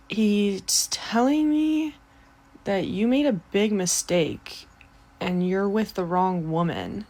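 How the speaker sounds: fake medium's message. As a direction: stretch it solemnly as if relaying a spirit. Fake message from dead father.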